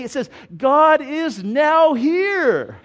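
put-on a little girl proudly reading out the sign; "God is now here" with "now" and "here" apart and lifted; child's eager discovery. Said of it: "God is, I, I know what it says".

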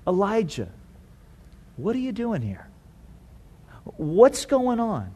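soft, gentle questioning; hushed, caring concern; tender. Shown as a demonstration. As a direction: ask softly and unhurriedly, without judgment. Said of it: "It's a tone, I think".

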